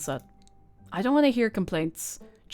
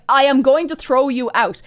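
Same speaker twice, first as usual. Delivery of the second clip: mock angry threat; 5 semitones higher, 9 dB louder than usual. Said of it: "And Tabitha's like".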